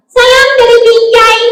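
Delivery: high, singsong puppet voice; high-pitched comic greeting; cheeky puppet. Acted. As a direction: call it out high as a mischievous puppet. Puppet gives a silly greeting.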